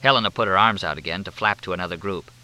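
usual speaking voice

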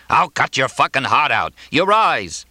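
high-pitched raging threat; furious threat. A narrator's reading. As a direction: hurl threats mid-fight. Threats while chasing his opponent.

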